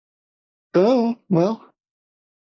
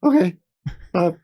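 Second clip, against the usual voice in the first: hasty goodbye; 6 semitones higher than usual. Retold or imitated. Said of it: "I was like".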